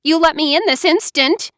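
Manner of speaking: high, loud angry order; furious demand. A narrator's reading. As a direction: demand it through the door. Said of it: "she sounded furious".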